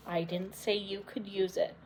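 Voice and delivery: a hushed accusing voice; quiet menace. Acted. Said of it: "Elfie got very quiet".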